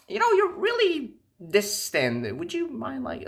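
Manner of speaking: high, slow mocking girl voice; mocking girlfriend. Guy mimics date's complaint in high mocking voice. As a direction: mimic her in a high pleading tone. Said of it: "she wanna talk about".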